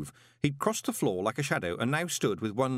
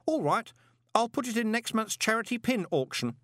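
put-on high-pitched, obliging boy; happy boy collector; 13 semitones higher than usual. A narrator's reading. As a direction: promise it in a high lad's voice.

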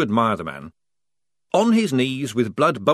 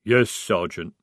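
growled; 2 semitones lower, slower than usual. Acted. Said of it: "growled the Troll".

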